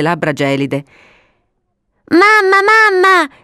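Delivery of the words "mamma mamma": put-on high, loud childish call; children's shout. Two children calling their mother from the garden, shouting. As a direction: call out eagerly for mum.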